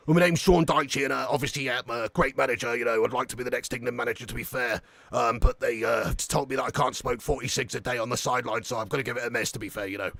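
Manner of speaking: low, soft football manager voice; deadpan manager speaking low and softly; Sean Dyche impression. Shown as a demonstration. Manager explaining himself to the press, low deadpan impression. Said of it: "Let's hear from Daichi".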